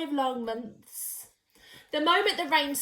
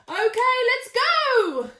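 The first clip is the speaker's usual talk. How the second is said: higher, louder, slow eager call; excited announcement; 9 semitones higher, 6 dB louder, slower than usual. A narrator's reading. Sheila announces the launch, higher and louder. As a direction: announce it like setting off on an adventure. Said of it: "Sheila announced".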